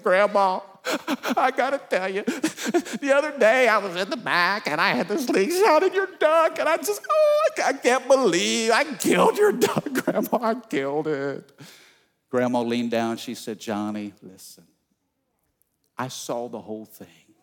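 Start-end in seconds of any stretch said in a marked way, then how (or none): 0.0-11.5 high, cracking, half-laughing confession; breaking down. Acted. Johnny confessing to grandma, voice breaking high. 13.6-17.3 low, much softer grandma; gentle grandma. Acted. Grandma to guilty grandson, soft and tender.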